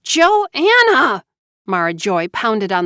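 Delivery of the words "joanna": loud, high furious shout of a name; furious call. A narrator's reading. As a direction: yell her name through the door in fury. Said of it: "she sounded furious".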